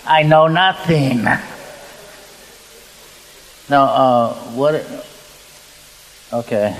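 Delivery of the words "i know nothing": loud, drawn-out, higher-pitched put-on voice; mock comic denial. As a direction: play up knowing nothing, loud and slow. Joking reply to German questioner.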